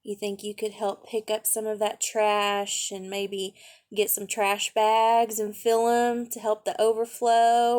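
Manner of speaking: usual speaking voice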